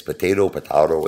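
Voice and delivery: normal talking voice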